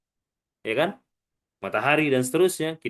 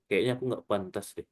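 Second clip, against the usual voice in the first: low, soft defeated mumble; mock unworthy murmur; imitated self-doubt; 8 semitones lower, 7 dB quieter than usual. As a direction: quote the doubter low and softly. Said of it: "yang ngomong".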